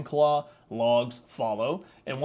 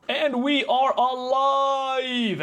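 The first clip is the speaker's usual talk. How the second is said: high, loud, drawn-out triumph; excited cheer; 11 semitones higher, 6 dB louder, much slower than usual. Real, not acted. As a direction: celebrate like it finally worked. Fix finally works.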